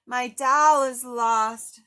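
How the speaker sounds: slow, loud, mournful wail; lost boy telling his monster friend; sad child voice. Acted. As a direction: drag out the loss like a heartbroken kid. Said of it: "said Harry".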